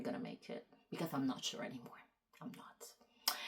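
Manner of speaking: soft whispered aside. Real, not acted. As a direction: breathe it out under your breath. Quiet admission at rant's end.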